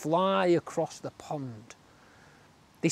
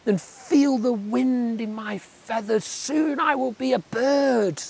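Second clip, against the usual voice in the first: high, slow dreamy wonder; excited tadpole; 7 semitones higher, slower than usual. A narrator's reading. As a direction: marvel at growing wings. Tadpole thrilled.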